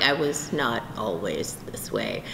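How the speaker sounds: low, slow, quiet aside; understated, slowed-down reply; deadpan correction. Retold or imitated. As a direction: drop low and soft to correct them. Deflating reply to gushing fans.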